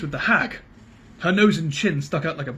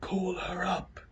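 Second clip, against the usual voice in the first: soft, unvoiced menacing rasp; grey terrible voice; 4 semitones higher, 9 dB quieter, much slower than usual. A narrator's reading. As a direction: breathe it like a ghoul. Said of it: "said the grey voice".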